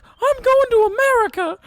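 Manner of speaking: squeaky falsetto, eager and loud; mock falsetto proud declaration; high-pitched voice. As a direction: announce it like a thrilled child. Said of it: "And he's like".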